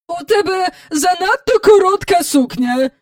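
high-pitched, bellowed criticism; indignant roar. A narrator's reading. Visitor criticising the girl's dress, indignant roar. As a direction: roar it disapprovingly. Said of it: "ревнула міс Ендрю".

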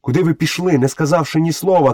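high-pitched cry at ordinary volume; calling out. A narrator's reading. Mowgli calls after his brothers, crying out. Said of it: "крикнув Мауглі".

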